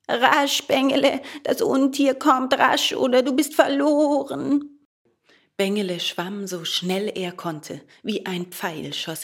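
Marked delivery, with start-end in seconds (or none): 0.1-4.8 high, loud, alarmed goat cry; high-pitched, shouted warning; bleating goat. A narrator's reading. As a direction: urge him on as a frantic goat. Goat cries warning to swimming puppet.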